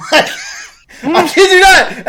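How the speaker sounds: can barely get the words out for laughing; laughing disbelief. Real, not acted. Streamer recalling the stream with friends, laughing hard. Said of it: "I laughed so hard".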